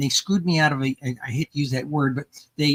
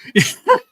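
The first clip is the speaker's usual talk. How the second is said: short high loud laugh; bright laugh bursting out; burst of laughter; 13 semitones higher, 9 dB louder than usual. Real, not acted. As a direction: let out a sudden high laugh. Laughs after daughter's remark.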